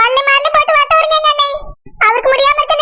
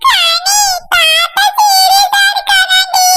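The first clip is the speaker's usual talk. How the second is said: high, slow wailing shout; crying shout; 8 semitones higher, much slower than usual. Acted. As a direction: call out, half crying. Child cries out to sister. (அழுதபடி உயர்ந்த குரலில் கத்தல்)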